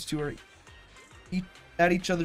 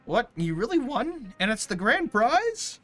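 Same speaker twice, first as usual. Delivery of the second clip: surprised; 7 semitones higher, 6 dB louder than usual. Acted. Reacting to surprise win.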